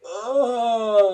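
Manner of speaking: loud high sleepy groan of a lazy engine; reluctant groan. A narrator's reading. Thomas groaning as he is made to start. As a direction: groan like you refuse to get up.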